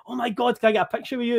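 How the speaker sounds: high-pitched starstruck gush; impressionable young fan. Young fan begging a celebrity for a picture, mock starstruck. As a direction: play a gushing teenage fan. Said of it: "very impressionable".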